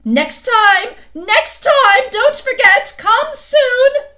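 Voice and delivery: high, loud call across the snow; queen calling farewell from a departing sledge. A narrator's reading. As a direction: shout after him as the sledge leaves. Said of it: "calling out".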